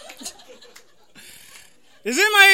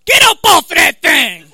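high, very loud punched shout; 7 semitones higher, 13 dB louder than usual. Acted. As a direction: holler it word by word like a soul singer. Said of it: "this is my impression of, uh, [name]".

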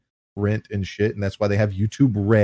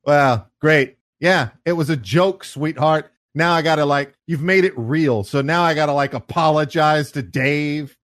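raised, put-upon comic protest; mock exasperated buddy; 3 semitones higher, 4 dB louder than usual. Buddy answering friend's girlfriend after joke, mock exasperation. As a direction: imitate a joker annoyed at being corrected. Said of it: "And then he's like".

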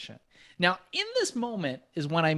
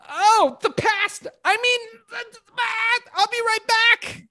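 mock dramatic reply; 5 dB louder, slower than usual. Own reply acted out.

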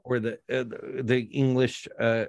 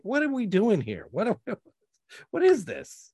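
exasperated disbelief, higher pitch; higher incredulous; 9 semitones higher than usual. Retold or imitated. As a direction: throw up your hands in disbelief. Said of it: "You're like".

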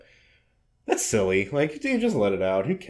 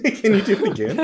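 mock request; 8 semitones higher than usual. Echoing friend's mock request.

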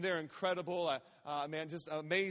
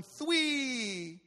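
put-on child's countdown; 6 semitones higher, 5 dB louder than usual. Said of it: "she starts going".

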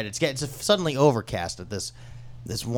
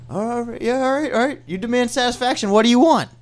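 high, pleading squawk, louder at the end; flustered managers giving in; 13 semitones higher, 7 dB louder than usual. Acted. Cornered managers answering the player's demand, flustered and high-pitched. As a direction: give in hastily in a high voice.

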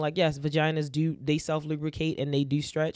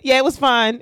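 higher and louder, brushing it off; high, loud dismissive reply; 10 semitones higher, 11 dB louder than usual. Retold or imitated. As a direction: toss it off in a high voice. Quoting woman's fake reply.

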